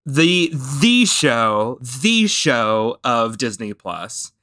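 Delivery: drawn-out, emphatic "the" before "show"; slow right down and lean on "the" as "thee"; "the" said long and weighty, as the one and only; stress on THE. Real, not acted. Singles out the flagship show.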